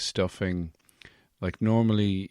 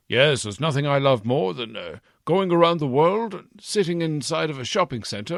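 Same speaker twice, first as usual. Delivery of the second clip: Santa voice; 7 semitones higher, 5 dB louder than usual. Acted. Santa voice, raised.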